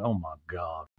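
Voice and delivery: low, quiet deadpan; Sutton. Retold or imitated. Sutton leaning on her arm unhappy, low quiet mutter. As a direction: drop it low and soft, unimpressed. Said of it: "Sutton's sitting still, leaning on her arm".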